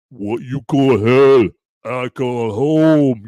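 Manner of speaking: slow, lightly growled action-hero line; a hint of Stallone, slowed right down; lightly done Stallone tough guy; Sly Stallone impression. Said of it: "dead on Sly Stallone impression".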